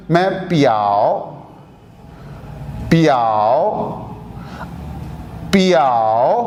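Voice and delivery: पियाओ repeated low and slow with a rising end; careful Mandarin pronunciation; Mandarin word drill. Shown as a demonstration. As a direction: model पियाओ slowly three times. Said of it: "अगर इसको बोलूँ मैं".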